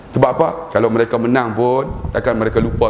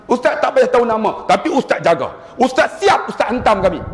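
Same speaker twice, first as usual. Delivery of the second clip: mock threatening caller; 9 semitones higher than usual. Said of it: "caller threatening, retold".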